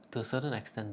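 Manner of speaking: usual speaking voice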